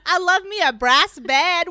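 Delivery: hammed-up furniture lover; gleeful Silent Brother. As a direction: gush over new furniture.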